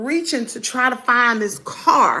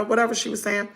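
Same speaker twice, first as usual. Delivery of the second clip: ordinary delivery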